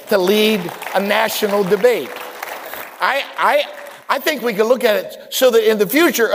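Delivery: loud, high, rising preacher's cadence; preaching at full force. Real, not acted. Pastor rousing his church about its future, preaching loud. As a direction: build the congregation up to a peak.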